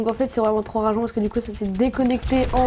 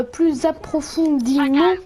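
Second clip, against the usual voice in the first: "approfondément" dragged out slowly and higher; effortful pronunciation; 4 semitones higher, much slower than usual. Real, not acted. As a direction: stretch "plus approfondément" syllable by syllable. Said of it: "j'arrive pas à parler". (« approfondément » articulé lentement avec effort)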